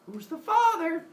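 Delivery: pry with a high, insistent voice; nosy questioner. Acted. Someone presses pregnant Semele, high and nosy.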